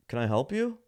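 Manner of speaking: high-pitched put-on clerk voice; store clerk. Clerk finally addressing waiting customer, mock clerk. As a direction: mimic a clerk, voice lifted high.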